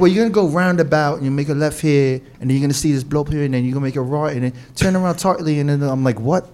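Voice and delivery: put-on lightly done British accent giving directions; a hint of a British local rattling off directions; mock British local giving directions. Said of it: "Your British accent's better than mine".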